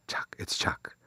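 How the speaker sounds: whispered "Chuck, it's Chuck"; voiceless, like a man who lost his voice. Retold or imitated. Dying friend calling on the phone, whispered.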